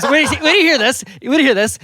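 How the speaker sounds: high, loud, eager little voice; mock excited baby. Dad voices his baby son insisting on telling a story, mock eager. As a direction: play the baby begging dad to listen, hammed up. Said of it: "he goes".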